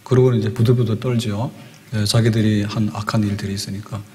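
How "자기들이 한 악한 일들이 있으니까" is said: usual speaking voice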